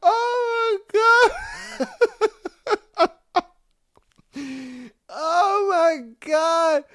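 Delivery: high, helpless laughing "oh my God"; cracking up. Real, not acted. Reader reacting to a customer's absurd reply, cracking up. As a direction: wheeze it out through real laughter. Real laughing fit at the story.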